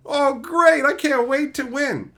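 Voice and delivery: high, loud mock excitement; sarcastic glee. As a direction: overdo the sarcastic cheer.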